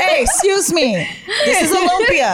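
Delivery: hammed-up, high-pitched food policing; mock culture police. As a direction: correct someone with mock authority.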